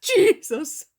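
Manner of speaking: high, loud desperate cry of a name; a mother screaming for help; shouting. Acted. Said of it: "Ellie does nothing but shout".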